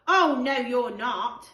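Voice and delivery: mock-outraged kid refusing; indignant refusal. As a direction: shut them out, loud and firm. George refusing entry.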